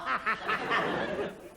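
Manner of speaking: staccato put-on giggling; hysterical laughing. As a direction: keep cackling like a tickled child. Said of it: "you piss yourself a laughing".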